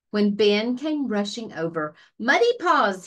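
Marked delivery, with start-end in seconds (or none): 2.2-3.1 calling out the puppy's name, eager and bright; lightly voiced boy bursting with news; excited shout. A narrator's reading. Ben calls his puppy with exciting news, excited shout. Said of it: "he shouted excitedly".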